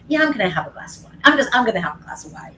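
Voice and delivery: little inner voice. Acted. A drinker's little inner voice talking them into wine, mock insistent. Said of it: "that little voice in your head saying".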